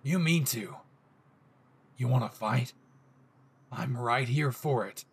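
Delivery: low, threatening yandere growl; dangerous possessive boyfriend voice; menacing Bakugo. Acted. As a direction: dare him to repeat it, seething. Bakugo voiced, picking fight.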